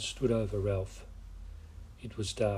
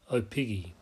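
usual speaking voice

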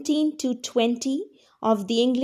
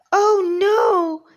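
high, louder gnome squeak of fright; frightened squeak; 8 semitones higher, 6 dB louder than usual. Acted. Trembling gnome hearing the dragon coming, high squeak. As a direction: squeak it out as a scared little gnome. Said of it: "he squeaked".

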